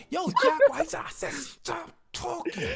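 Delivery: hammed-up threat through laughter; mock angry bodyguard. Bodyguard warning the party guest, hammed-up high threat. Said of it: "He says".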